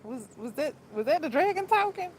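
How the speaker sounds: incredulous, voice shooting up; disbelieving question. Retold or imitated. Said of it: "I be like".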